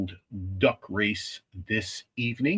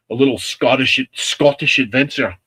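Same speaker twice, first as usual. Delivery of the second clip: louder, hammed-up attempt at Scottish; poor Scottish accent; 2 semitones higher, 8 dB louder than usual. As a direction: badly fake a Scottish accent, punching it out. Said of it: "my poor attempt at a Scottish accent".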